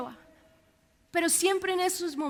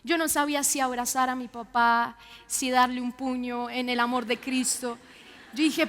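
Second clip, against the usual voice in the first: ordinary talk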